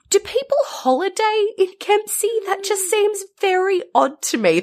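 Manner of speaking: high, puzzled disbelief; incredulous. Real, not acted. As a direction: ask it in genuine disbelief. Incredulous question about Kempsey.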